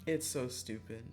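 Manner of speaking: ordinary delivery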